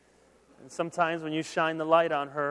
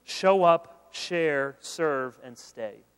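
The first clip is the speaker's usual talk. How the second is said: each word punched, fading to stay; emphatic list; 4 dB louder, much slower than usual. Real, not acted. Pastor giving students four pieces of advice, emphatic list. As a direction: count off the four with weight. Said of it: "four quick pieces of advice".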